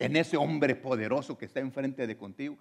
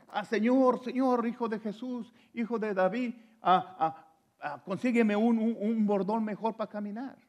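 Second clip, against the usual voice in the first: higher, hesitant mock plea with ah, ah; mock petty request; 8 semitones higher than usual. Preacher mocking what the blind man did not ask, hesitant mock plea. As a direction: play a dithering beggar asking for trifles. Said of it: "no le pidió a Jesús".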